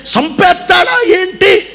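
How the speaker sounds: high, slow mock alarm; alarmed vine. Vine fearing the gardener will kill it, high mock fright. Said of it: "అన్నట్టే".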